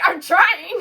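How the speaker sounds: mock whining I'm trying; whiny retort. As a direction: whine back at the cops. Retorting to cops.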